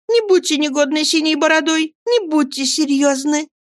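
put-on high, girlish pleading; coaxing young wife. A narrator's reading. Dora begs her husband not to be serious, coaxing. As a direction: beg in a high, childlike voice.

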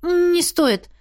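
high-pitched, embarrassed refusal. A narrator's reading. Heroine declines the dragon's offer to undress, embarrassed. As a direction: decline in confusion. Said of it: "сконфуженно пробормотала".

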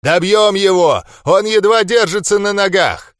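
loud, high battle command; furious shout. A narrator's reading. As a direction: roar them into the attack. Enraged chieftain urging attack.

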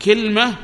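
كلمة as "kilma", high and loud; short i, no vowel after l in كلمة; second dialect form. Shown as a demonstration. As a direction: say كلمة as kil-ma, held up. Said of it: "ففيه ثلاث لغات".

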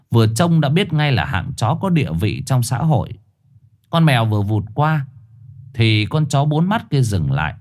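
plain reading voice

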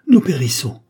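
loud, narrated cry of doom; terrified cry. A narrator's reading. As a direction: cry out loudly that all is lost. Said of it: "jetèrent un cri terrible". (cri de terreur)

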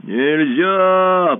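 loud, otherworldly roar; roaring monster. A narrator's reading. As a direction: roar the refusal. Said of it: "проревело чудовище".

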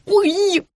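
high-pitched little squeal; squealed "ouch". A narrator's reading. Woman pinched mid-call, squealing. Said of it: "взвизгнула я".

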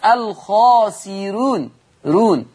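slow "الخاسرون" with a light ra, then "رون" alone; thin ra. Shown as a demonstration. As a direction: stretch "الخاسرون" and keep the ra thin. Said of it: "أنا عايز". (ترقيق الراء في "الخاسرون")

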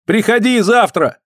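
high, pleading call; acted parting cry. A narrator's reading. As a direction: call after her before she is gone. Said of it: "крикнул Андрей".

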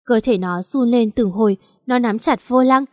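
ordinary delivery, nothing marked

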